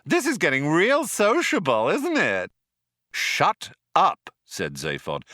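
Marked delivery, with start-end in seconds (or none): 0.0-2.5 high, gushing computer voice; enthusing computer. A narrator's reading. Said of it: "enthused the computer". 3.1-4.3 higher, louder cut-off; irritated command. A narrator's reading. Zaphod cuts off chirpy computer.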